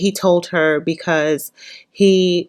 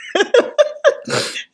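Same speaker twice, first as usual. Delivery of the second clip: smirking giggle. Retold or imitated. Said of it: "he starts smirking like".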